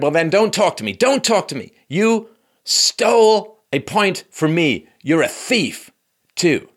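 high, forceful accusation with drawn-out key words; Serena Williams berating. Retold or imitated. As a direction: hammer out the accusation in mock fury. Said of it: "started to verbally abuse Ramos".

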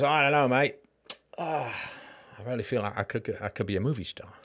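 put-on a light Australian accent, fading out; young Heath Ledger in mock Australian, trailing off. Heath Ledger at sixteen dreams aloud, mild Australian accent. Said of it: "I've completely lost the accent".

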